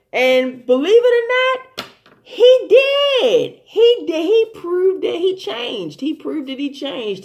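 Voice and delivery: high, loud, drawn-out emphasis; emphatic amazement. Real, not acted. Excited, emphatic praise of his change.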